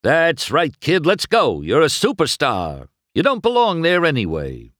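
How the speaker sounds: high, loud schmoozing agent; coaxing. A narrator's reading. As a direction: sweet-talk him into the trailer. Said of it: "The agent coaxed".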